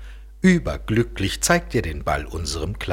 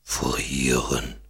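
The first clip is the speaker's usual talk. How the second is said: lower, puzzled echoing question; verwundert; 5 semitones lower than usual. Acted. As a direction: repeat the word, not understanding it. Polar bear repeats, puzzled. (tiefer, verwunderter Eisbär fragt „Frieren?“ nach)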